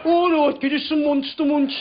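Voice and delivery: imitated Birmingham flatmate; Birmingham accent. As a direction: give in with broad Birmingham vowels. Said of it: "in his Birmingham accent".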